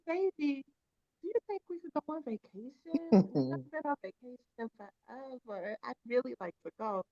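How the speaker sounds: put-on sweet girlfriend voice. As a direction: coax him sweetly for a trip. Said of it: "we change our voices too".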